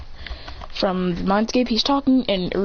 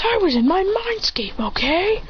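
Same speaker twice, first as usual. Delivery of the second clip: indignant excuse; 9 semitones higher than usual. A narrator's reading. Student justifies himself to teacher.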